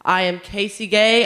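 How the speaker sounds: ordinary delivery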